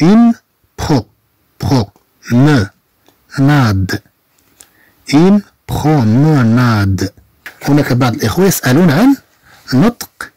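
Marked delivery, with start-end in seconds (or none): 0.1-3.9 promenade broken into slow separate syllables. Shown as a demonstration. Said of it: "نقطعها إلى مقاطع لفظية". 5.2-7.1 promenade drawn out slowly in one go; slow whole word. Shown as a demonstration. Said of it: "هذه تنطق دفعة واحدة".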